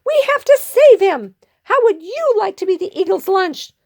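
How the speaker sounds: high, squeaky rallying plea; urgent little hero. Acted. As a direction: push them to help, quickening. Pip rallies the crowd.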